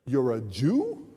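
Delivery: slow, soft, sharply rising question; wondering question. Retold or imitated. Station man to Mark on hearing his terms, slow amazed question. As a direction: draw it out in dawning surprise.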